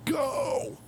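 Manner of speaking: sharp high yelled go; impatient yell. Retold or imitated. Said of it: "I will yell".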